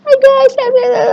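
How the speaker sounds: shrill exaggerated sweetness, way too much; over-the-top greeting. Shown as a demonstration. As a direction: overdo the hello like a gushing fan. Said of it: "nggak asik juga orang lihatnya".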